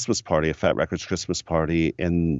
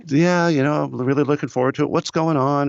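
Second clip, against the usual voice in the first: higher, fast Tony voice; imitating Tony; 9 semitones higher than usual. Tony chatting before his flight, higher and fast. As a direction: rush it out, upbeat. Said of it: "and he goes".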